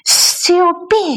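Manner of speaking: high, drawn-out call for silence; shushing. Acted. Quarrel is shushed again.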